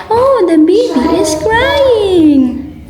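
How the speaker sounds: high, slow, exaggerated sing-song; Miss Rachel. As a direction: imitate a children's host cooing, with long stretched vowels. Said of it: "she watched Miss Rachel and then there's some baby cry".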